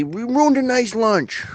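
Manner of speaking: high, drawn-out movie quote; mock movie tough guy. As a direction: stretch it out like a quoted threat. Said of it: "and say".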